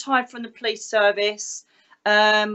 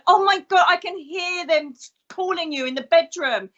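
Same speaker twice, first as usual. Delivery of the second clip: mock the alarmed partner, pitched up; imitated alarm; 6 semitones higher than usual. Partner hearing spirits in the bedroom, mock alarmed outcry.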